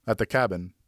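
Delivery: ordinary delivery, nothing marked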